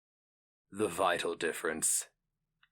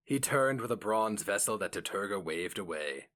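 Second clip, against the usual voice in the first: plain reading voice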